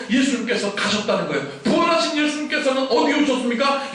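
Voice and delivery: loud, high, urgent preaching; preaching at full force. Acted. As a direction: drive it home to the congregation. Sermon climax, rising intensity.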